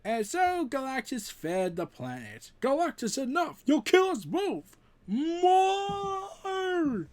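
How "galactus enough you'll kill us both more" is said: high, loud, straining cry; desperate shout. Acted. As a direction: scream at him to stop. Thor shouting at feeding Galactus.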